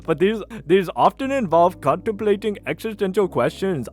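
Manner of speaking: hammed-up Indian accent. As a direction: read it in a put-on Indian accent. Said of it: "with an Indian accent".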